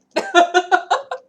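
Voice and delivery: high, loud burst of laughter; big laugh. Real, not acted. Streamer laughing at her own joke, loud laugh. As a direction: let a real laugh explode out.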